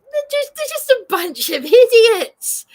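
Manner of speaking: loud, high, furious rant; shouting about the police; angry outburst. Real, not acted. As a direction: spit it out in anger.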